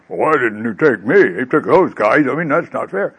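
put-on whiny protest, swinging pitch; play-acted sulky grievance; mock complaint. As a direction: complain like someone who got left out. Left-behind disciple complains.

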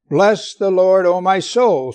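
loud, emphatic scripture reading. Shown as a demonstration. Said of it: "for emphasis, repeating it".

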